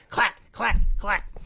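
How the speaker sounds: high, sharp percussive clacks; punchy mimicked impact noise; imitated chair hits. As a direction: crack each word like a chair shot. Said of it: "somebody's like".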